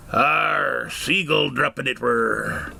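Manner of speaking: slow gruff pirate growl, arr. Acted. Pirate answering the curious dad, slow and growly. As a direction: play the salty pirate, drawn out. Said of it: "he says".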